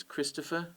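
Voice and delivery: "Christopher" with the stress on Chris; stress on first syllable. Shown as a demonstration. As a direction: say "Christopher", leaning on the opening Chris. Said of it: "I put the stress on Chris".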